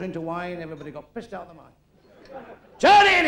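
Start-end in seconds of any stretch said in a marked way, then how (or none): 2.8-3.3 high, loud bellow; furious shout. Acted. Jesus roaring at the women throwing stones, furious shout. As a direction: roar it to stop the crowd.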